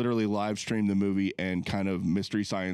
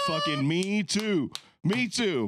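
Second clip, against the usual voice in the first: excited; 8 semitones higher, slower than usual. Real, not acted. Eager agreement.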